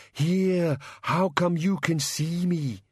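high, slow monster voice; drawn-out, high creature question; puzzled bogeyman. Acted. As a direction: ask slowly as a baffled bogeyman.

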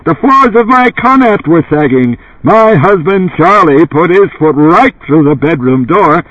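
high, brassy testimonial voice; brassy bellowing housewife. A narrator's reading. Housewife praising Ubik in a TV advert, brassy bellow. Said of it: "In a brassy voice she bellowed".

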